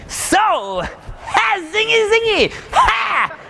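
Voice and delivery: high shouted, hammed-up stage hype; overhyped speaker. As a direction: play a manic motivational speaker. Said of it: "imagine you have some speaker up here".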